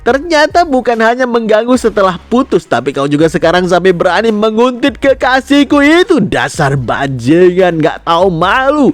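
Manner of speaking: heightened, high-pitched angry accusation; marah menuduh. Acted. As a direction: lay into him, voice climbing high. Pria menuduh dan memaki Hyun. (menuduh dengan marah, nada tinggi)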